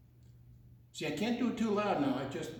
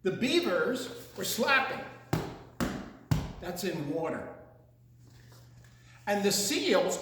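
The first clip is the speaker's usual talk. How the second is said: ordinary delivery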